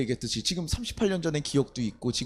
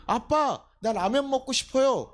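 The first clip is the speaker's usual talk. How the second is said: higher, lightly voiced child; child asking dad; 7 semitones higher, slower than usual. Child asking dad to buy ramen, lightly voiced pleading. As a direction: ask dad like a hungry kid, lightly acted. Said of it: "아빠가 가서 라면을 사주면".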